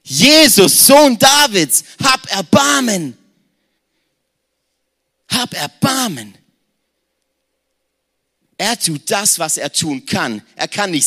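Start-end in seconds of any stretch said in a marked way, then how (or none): 0.0-6.3 laut und hoch hinausgeschrien; verzweifelter Schrei. Acted. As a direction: ruf um Hilfe aus voller Kehle. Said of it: "wirft seinen Mantel weg und schreit". (Bartimäus schreit verzweifelt nach Jesus)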